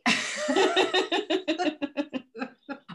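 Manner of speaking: helpless high-pitched giggling; breaking up in giggles; high giggle fit. Real, not acted. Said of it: "I'm trying to be professional".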